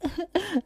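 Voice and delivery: a short sobbing breath; child sobbing over mother's body; child's sob. Acted. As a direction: let out one small sob. Child weeps over dead mother.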